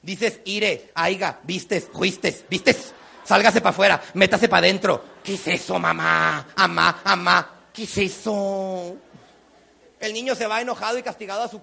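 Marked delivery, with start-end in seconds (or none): 0.4-5.0 punchy colloquial "ire, aiga, vistes, juistes"; mother's bad Spanish. Mom speaking Spanish badly, folksy "vistes, juistes". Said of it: "Y mal". 5.2-9.0 slow, drawn-out appalled objection; complaining son. Retold or imitated. Son corrects mom's bad Spanish, mock dismay.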